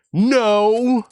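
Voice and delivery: usual speaking voice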